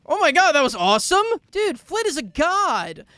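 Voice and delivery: loud, high hype; thrilled praise. Real, not acted. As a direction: cheer the hero on, awestruck. Thrilled by hero's rescue.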